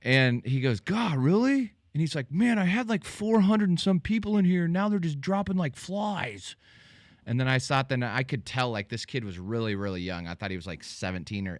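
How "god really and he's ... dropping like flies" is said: imitating a whiny guy, pitched higher; mock complaining guy. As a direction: play the put-upon streamer, voice pushed up. Retold streamer complaining about viewers.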